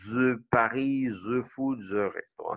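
put-on "the" as "zuh" in a French accent. Teacher mocking the president's English, thick French accent. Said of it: "tu vas dire comme notre président là".

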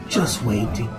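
ordinary delivery, nothing marked

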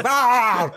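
high mock yelp of someone falling; a high yelp as he drops into oblivion. Punter falling off the tile.

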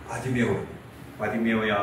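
very low, soft call by name; gentle calling. Acted. Jesus stops and calls Bartimaeus, low soft call. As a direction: call him softly, deep in pitch. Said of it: "하고 불러요".

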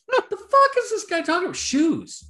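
high-pitched incredulous outburst; retold bafflement. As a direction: squeal it in mock disbelief. Retelling his baffled reaction.

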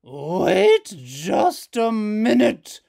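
slow, very loud booming mother. Acted. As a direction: boom down from above. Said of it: "voice boomed out from overhead".

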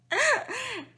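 high burst of laughter; laughing. Real, not acted. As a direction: break up laughing. Can't stay serious.

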